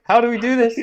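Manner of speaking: hammed-up marines at a loss; loud, high mock confusion; confused cry. Confused marines in retold joke.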